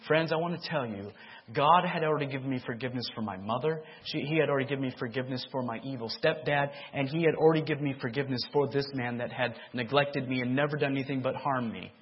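normal talking voice

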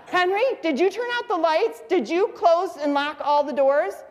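high-pitched irate wife imitation; scolding mother. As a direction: fire questions at your husband, pitched high. Mother scolds honking husband again.

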